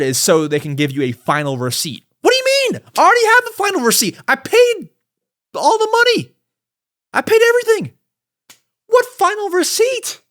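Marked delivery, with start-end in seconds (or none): 2.2-10.2 much higher, incredulous ranting; fired-up outrage. Real, not acted. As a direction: sputter in real exasperation. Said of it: "I'm fired up".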